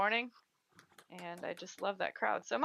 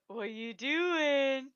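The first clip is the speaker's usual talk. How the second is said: very slow, higher, stretched "What you doing?"; the cat-calling voice; 6 semitones higher, 3 dB louder, much slower than usual. Retold or imitated. Calling to the mountain lion at the zoo, slow and stretched. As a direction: draw it out high to get the mountain lion's attention. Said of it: "I did the".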